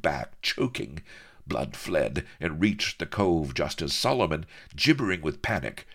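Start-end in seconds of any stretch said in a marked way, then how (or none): none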